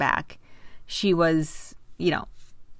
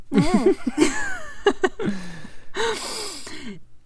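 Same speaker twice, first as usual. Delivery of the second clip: long high laugh; 12 semitones higher than usual. Real, not acted.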